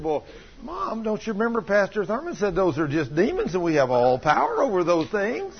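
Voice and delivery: lightly acted child's high, earnest question; imitated earnest child. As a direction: explain it to Mom as a sure little boy. Boy earnestly reminds his mother.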